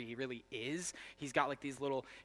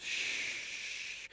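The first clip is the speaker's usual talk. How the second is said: whispered shh. Retold or imitated. Son hushing his drunk father, whispered. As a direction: hush him gently in a whisper. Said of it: "and he goes".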